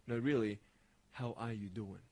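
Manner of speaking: low, soft and earnest check-in; sincere concern. Acted. Checking on a friend who says okay, soft and sincere. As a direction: ask it gently, as if you truly mean it. Sincere follow-up to casual question.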